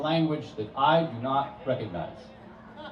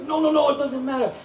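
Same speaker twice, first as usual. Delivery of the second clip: much higher, louder brush-off; dismissive visitor; 12 semitones higher, 5 dB louder than usual. Retold or imitated. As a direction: wave it away airily.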